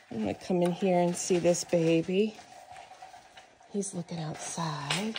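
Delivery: ordinary talk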